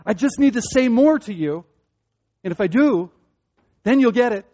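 high, loud, pressing to get through; over-earnest explainer, climbing in pitch; insistent pleading. Acted. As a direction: push harder so they finally understand. Acting his insistent over-explaining to someone.

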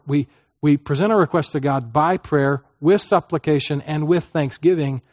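usual speaking voice

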